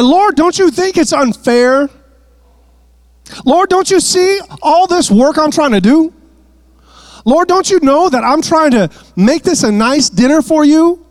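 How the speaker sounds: imitating a flustered hostess begging for fairness; frustrated, upset plea. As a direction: voice Martha's frustrated whining to the Lord. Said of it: "worried, frustrated, and upset".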